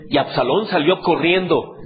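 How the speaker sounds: usual speaking voice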